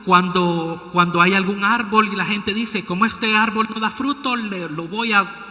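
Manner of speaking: loud fervent pulpit call to bear fruit; full-force preaching. Acted. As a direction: preach it hard to the congregation.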